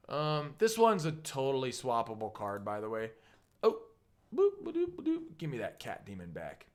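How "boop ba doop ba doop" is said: high-pitched little "boop, ba-doop" noises; playful boops. Pretend. Presenter taking back a card with high, playful boops. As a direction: make cartoonish high blips.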